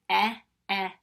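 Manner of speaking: Eh, eh as two short loud ehs like elephant; short e sounds. Shown as a demonstration. Teacher leading the vowel chant, loud short e. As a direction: call out the short e twice. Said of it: "as I say the sound".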